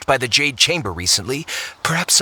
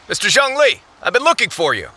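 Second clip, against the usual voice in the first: raised, high voice hailing someone; calling out; 9 semitones higher, 4 dB louder than usual. Acted. Hailing the consultant from afar, loud and bright. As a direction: call across to get his attention. Calls out to someone across distance.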